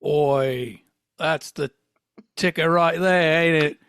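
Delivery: hammed-up mock Cockney; muddled British accent. Fake Biff reacts to the joke question, muddled Cockney accent. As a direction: put on a wobbly London accent. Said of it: "I don't know what accent I'm doing now".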